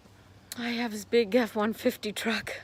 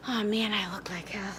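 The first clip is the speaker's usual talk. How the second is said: whisper; 2 semitones lower, 6 dB quieter than usual. Real, not acted. Aside to camera about her looks.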